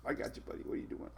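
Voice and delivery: soft unvoiced whisper to a puppy; whispering to the dog. Real, not acted. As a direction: hush it, just breath for the pup. Soothing the dog on his back.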